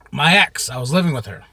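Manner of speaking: ordinary talk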